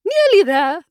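high echo of the question; Queen's retort. A narrator's reading. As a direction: throw the words back, high and brisk. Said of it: "the Queen repeated".

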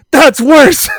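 mock yell. Heckler shouts down the Canadian's reply, loud high jeer. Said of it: "And he goes".

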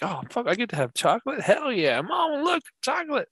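put-on high, thrilled little kid; excited kid voice. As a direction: squeal it like a kid who found candy. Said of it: "He's like".

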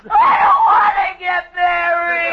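crying. Acted. Boy crying before operation.

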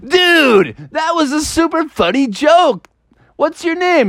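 very high, enthusiastic dude voice; high excited kid toad. Acted. Toadstool praising Froggy's joke, very high and excited. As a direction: cheer it out high like a stoked kid.

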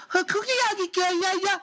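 very high-pitched mock gibberish; mock foreign girl. Girl in China talking on the app, high mock foreign gibberish. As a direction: squeal out made-up foreign chatter. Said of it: "talk to some girl".

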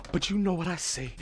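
ordinary talk, nothing marked

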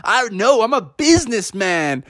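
high, swaggering hype-bro voice; bro-talking employee. Acted. Employee bragging to the bears about business, cocky bro voice. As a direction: boast like a hyped-up salesman bro. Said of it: "And they said".

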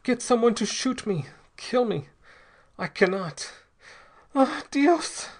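high-pitched, anguished pleading; terrible despair. A narrator's reading. Possessed man begging to be killed, in despair. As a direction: plead desperately, rising to a loud high cry. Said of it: "in a voice of terrible despair".

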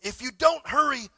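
loud urgent prophet's call; a prophet shouting urgent orders; Elijah shouting. Retold or imitated. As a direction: shout the warning to hurry. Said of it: "Elijah shouted".

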